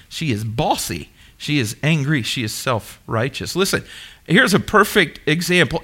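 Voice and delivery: ordinary talk, nothing marked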